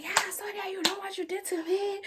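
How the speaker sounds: high mock-whiny accusation; retold accusation. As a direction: imitate the raving friend in high pitch. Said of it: "So she's like".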